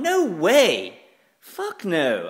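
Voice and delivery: high, loud outraged no; defiant refusal. Retold or imitated. Refusing the planners in the meeting, loud and high. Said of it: "I would have gone".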